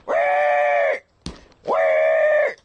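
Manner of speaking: loud drawn-out squeal; pig squeal. Acted. Streamer playing the pig for chat, loud squeals. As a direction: let out piercing pig squeals. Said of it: "Squeal like a pig".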